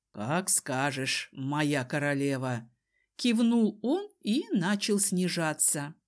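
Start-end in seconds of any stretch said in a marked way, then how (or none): 0.1-2.7 obliging Desmond. A narrator's reading. Desmond obeys his queen, low and deliberate. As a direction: yield in a deep, unhurried voice.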